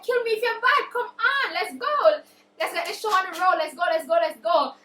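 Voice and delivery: loud, high taunt; acted challenge, pushing for a fight; goading dare. Acted dare, goading him to attack.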